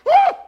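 piercing high loud shriek of panic; panicked shriek. Retold or imitated. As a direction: scream as the roach moves. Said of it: "your whole body has like a hot flash".

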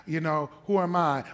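ordinary delivery

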